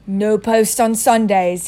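slow cheerful uncle voice. A narrator's reading. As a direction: gloat it happily. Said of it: "he reminded them cheerfully".

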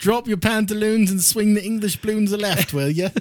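high, loud, hammed-up English doctor; mock English accent. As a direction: boom it out in silly English tones. Joins the mock English doctor bit.

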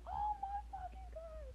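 very high, drawn-out starstruck squeal; ecstatic fan squeal. Retold or imitated. Fan reacting to surprise star at first concert, high ecstatic squeal. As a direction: relive it in a slow, sky-high squeal.

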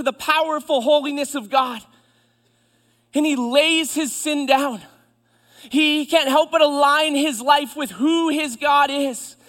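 high, loud, driving sermon delivery; full-force preaching. Real, not acted. Preacher tells church of Isaiah overwhelmed by holiness, full-force preaching. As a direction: pound out each repeated phrase with fervor.

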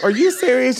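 hammed-up surprise; incredulous. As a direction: act amazed they asked. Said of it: "I'm like".

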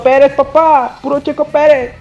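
emocionado. Real, not acted. Fan emocionado por Checo Pérez.

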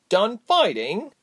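high, firm interjection; interrupting. A narrator's reading. Reshma cutting off Gilly, firm and high. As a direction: cut in with drawn-out emphasis. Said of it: "Reshma interrupted".

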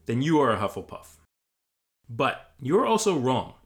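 ordinary delivery, nothing marked

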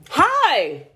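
high, bright show-host hello; sing-song welcome to the party; bubbly host greeting. Acted. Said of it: "Okay, I'm sorry. Let me start again".

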